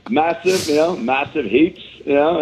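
high, hammed-up Aussie twang on massive heaps; mock Australian accent. Comedian bragging to hosts, hammed-up Australian accent. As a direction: ham up the Aussie slang. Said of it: "picked up a little bit of an accent".

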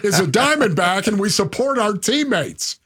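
Ketel Marte lines pitched well up, repeated firmly; insistent stock answer. Pretend. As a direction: insist on the party line, voice climbing high. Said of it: "here I am. I'm a dude".

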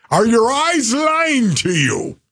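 put-on Scottish accent, drawn-out and emphatic; Scottish coach accent. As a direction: question them like an old Scottish coach. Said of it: "he said it with that kind of accent".

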